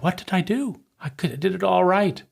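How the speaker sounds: a hint of a bewildered kid asking what they did; child protesting they did it all right, mildly voiced; confused child. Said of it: "the question of the child".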